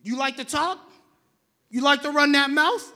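loud rising taunt; mock-confrontational shout; loud challenge. Real, not acted. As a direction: call out the talker. Challenging the congregation.

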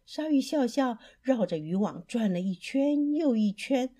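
ordinary delivery, nothing marked

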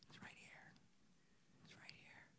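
breathy hushed whisper; hushed stage whisper. Acted. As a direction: lean in and whisper it like a secret. Hushed aside to the crowd.

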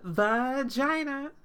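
hammed-up shout of "Vagina" stretched long; retold scream. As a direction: belt out "Vagina" slowly, pitch up. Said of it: "she just screams".

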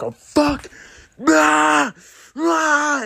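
hammed-up high-pitched squeal of annoyance; mock pained yelps. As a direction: yelp it out like you just smashed something. Someone drops something, acted.

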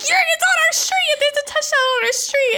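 very high, loud panicked squeal; retold freaking out. Re-enacting her panicked warning to Yuri, squealing in alarm. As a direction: shriek it in a frantic high voice. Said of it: "I'm freaking out".

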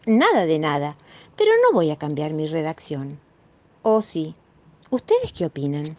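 usual speaking voice

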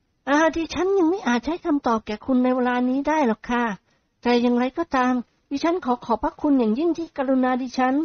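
put-on high, soft, polite girl voice; sweet polite young lady; young woman voice. Model answers softly. (เสียงหญิงสาวสุภาพอ่อนหวาน)

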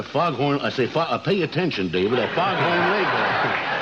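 Southern rooster voice, talking down to the host; Foghorn Leghorn voice. Acted. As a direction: bellow it like a pompous big rooster. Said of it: "he talks like that".

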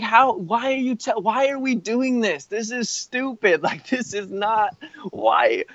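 high-pitched, bewildered questioning; acted-out exasperation; exasperated protest. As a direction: replay your disbelief in a high, pleading voice. Retells protesting the dangerous trip.

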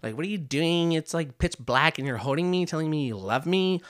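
high, put-on complaining wife; imitated wife. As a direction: imitate a baffled wife, pitched well up. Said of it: "And she's just saying".

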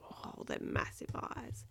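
whispered, slow aside; hushed awe. Acted. Parent showing a child the creature's eyes, awed whisper. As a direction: whisper it in wonder at the sight.

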